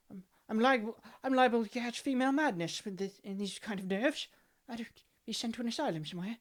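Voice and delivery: put-on high, fussy, nervous old aristocrat; flustered Victorian gentleman; fretful old lord.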